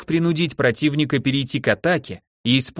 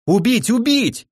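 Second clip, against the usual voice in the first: hysterical shout rising higher; frantic shouting hostage taker; 10 semitones higher, slower than usual. A narrator's reading. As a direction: scream the order, frantic and higher. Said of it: "истерично закричала Люцина".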